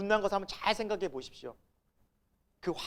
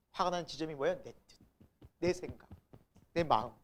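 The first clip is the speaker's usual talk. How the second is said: ordinary talk, nothing marked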